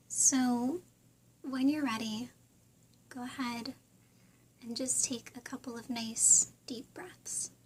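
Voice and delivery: soft, unhurried meditation voice with long pauses; guided meditation. Real, not acted. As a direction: gently guide listeners through breathing and visualizing.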